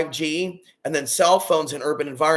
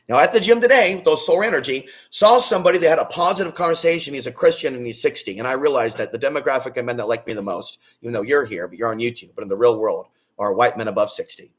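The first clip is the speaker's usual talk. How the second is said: ordinary delivery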